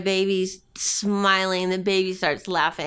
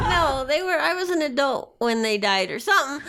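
ordinary talk

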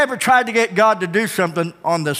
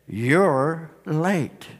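low, soft, hushed reproach; downcast Mary; 4 semitones lower, 5 dB quieter than usual. Acted. Mary fallen at the feet of Jesus, downcast. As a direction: let the two words drop quietly, far apart. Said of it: "She falls on her face".

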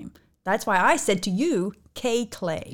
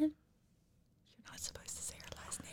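whispering; 7 semitones higher, 19 dB quieter than usual. Real, not acted. Scolding in a hushed aside.

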